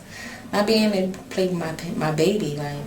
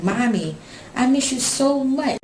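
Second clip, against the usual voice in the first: put-on higher, slow pleading little girl; imitating her daughter; 7 semitones higher than usual. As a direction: draw it out like a child missing her mom. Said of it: "she be like".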